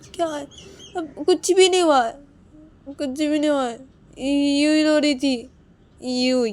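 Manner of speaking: high, loud go-away shouting; angry dismissal. Acted. Wife shouting at husband to leave, angry. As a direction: drive him out of the room.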